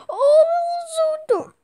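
high squeal of delight. Real, not acted. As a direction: squeal over a cute puppy. Squealing over adorable puppy.

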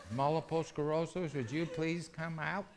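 very soft, timid mock prayer; near-whisper. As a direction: keep it hushed and polite. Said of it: "Instead of whispering".

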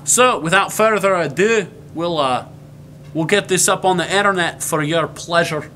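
presenting in a put-on Scottish accent; Scottish brogue. Introducing the web series to viewers, Scottish brogue. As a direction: hold the Scottish brogue while signing off. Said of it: "consistent with the Scottish brogue".

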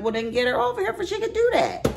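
high, fast mock indignation; imitated pushy retort, rushed and high; retold sassy comeback. Retells her comeback at salon.

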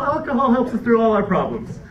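ordinary talk, nothing marked

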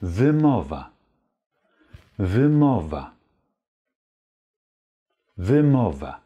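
wymowa with a clear y like an open Ä; model wymowa. Shown as a demonstration. Teacher repeats the word for learners to copy. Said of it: "Mit einem schönen Ä".

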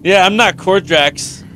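usual speaking voice

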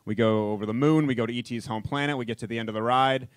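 normal talking voice

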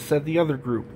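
ordinary delivery

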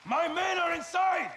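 high, slow, furious yell; outraged shout. Acted. Commander shouting at Graves that his men are inside. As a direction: bellow it in disbelief.

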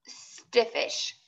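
ordinary delivery, nothing marked

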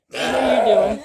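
loud call across the field to a lamb. Real, not acted. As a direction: shout to the lamb from a distance. Calls out to a sheep.

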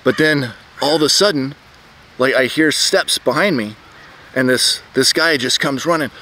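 ordinary delivery

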